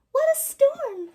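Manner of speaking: very high, birdlike twitter; a little bird chirping about the storm; high chirpy bird voice; twittering wren. A narrator's reading. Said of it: "twitters wren".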